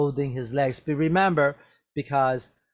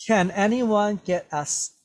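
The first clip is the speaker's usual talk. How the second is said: higher-pitched, mildly insistent demand; raised demanding voice; 7 semitones higher than usual. Acted. Teacher reading Sharon's line to students, mildly demanding. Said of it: "demanded Sharon".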